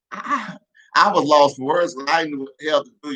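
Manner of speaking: ordinary talk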